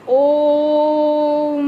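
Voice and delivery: ओम held very long on a level tone; prolonged vowel. Shown as a demonstration. Teacher showing a triple-length vowel, prolonged ओम. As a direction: stretch ओम right out into a humming m. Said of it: "इसमें बहुत लंबा समय लगता है".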